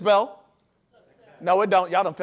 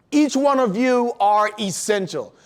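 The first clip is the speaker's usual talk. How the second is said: usual speaking voice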